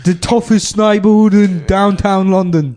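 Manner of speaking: Snatch Adams. Acted. British comic playing a foul doctor to a sick kid, high hammed-up abuse. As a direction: berate a sick child in a hammed-up English accent. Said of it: "It's the same character from before".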